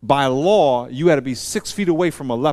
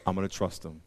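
softer, lower self-talk; a worrier talking himself into trust, softer; quiet reassurance; 5 semitones lower, 8 dB quieter than usual. Shown as a demonstration. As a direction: calmly assure yourself. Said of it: "stop yourself short and say".

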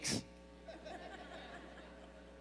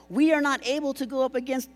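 high-pitched mock whine; whiny voice; 8 semitones higher than usual. Preacher reading the spies' refusal, in a whine. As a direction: put on a whiny complainer's voice. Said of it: "that's my whiny voice".

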